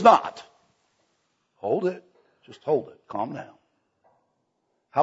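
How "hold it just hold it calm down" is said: much softer, settling the room; mock calming. Real, not acted. Preacher quieting laughing congregation, soft mock calm. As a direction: hush them down playfully.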